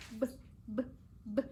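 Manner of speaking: short soft "b" pops, not the letter name; isolated letter sound. Shown as a demonstration. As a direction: say "b" alone, softly and briefly. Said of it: "los sonidos".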